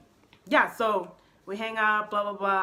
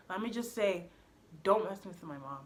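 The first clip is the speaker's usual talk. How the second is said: ordinary talk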